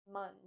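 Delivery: Mund as a short MUN; like month. Shown as a demonstration. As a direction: model Mund with the vowel of month. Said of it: "Kind of like the word month".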